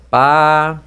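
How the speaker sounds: "Paraguai" stretched out as PA... RA... GUAI; loud, drawn-out syllables in "Paraguai". Shown as a demonstration. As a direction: sound out "Paraguai" with long gaps. Teacher splits word into syllables.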